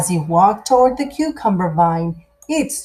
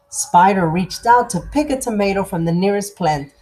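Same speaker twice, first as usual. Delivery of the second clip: ordinary delivery, nothing marked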